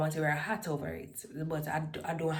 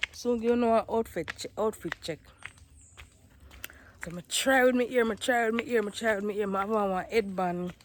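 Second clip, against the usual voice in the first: loud, higher Caribbean patois delivery; 5 semitones higher, 9 dB louder than usual. Acted. Dolly showing viewers her church look, in loud patois.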